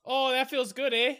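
high, hammed-up Canadian accent with a drawn-out eh; mock Canadian accent. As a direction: play a jokey Canadian hockey guy, Canadian accent. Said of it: "Just the Canadian accents while you're doing it".

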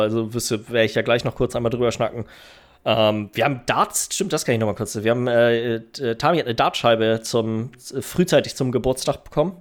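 ordinary delivery

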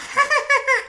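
high squeaky puppet giggle; dad doing Elmo's high giggle. Retold or imitated. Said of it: "my little Elmo voice".

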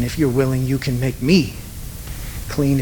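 low line with "me" leaned on before a pause; stress on me. Shown as a demonstration. Preacher working through the verse, stressing "me". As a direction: press "me", pause, then let "clean" fall. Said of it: "emphasize each word individually time after".

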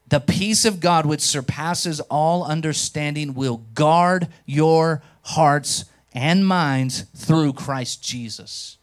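slow, reverent reading of scripture; scripture read slowly. Acted. Pastor reading the verse to the church, slow and solemn.

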